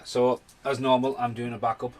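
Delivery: ordinary talk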